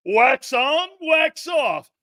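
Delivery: loud, high karate-movie cry; shouted wax on. Pretend. Shouting the catchphrase at the guys. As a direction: yell it like the karate master.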